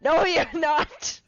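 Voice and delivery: loud, high, drawn-out protest; laughing disbelief. Real, not acted. Friend rejects the dyslexia excuse, incredulous outburst.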